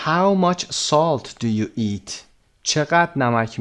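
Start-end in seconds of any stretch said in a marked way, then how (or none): 0.1-2.1 very slow, carefully spaced words; slow model reading. Shown as a demonstration. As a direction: read it out slowly for learners. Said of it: "teacher reads question slowly".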